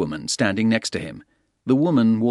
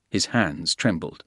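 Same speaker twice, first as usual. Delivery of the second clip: ordinary delivery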